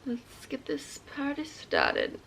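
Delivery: slow excited whisper. Real, not acted. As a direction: hush it out, slowly. Early morning, whispering on camera.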